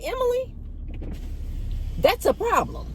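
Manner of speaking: sharp, high-pitched outrage at Emily; indignant. Retold or imitated. Confronting the agent.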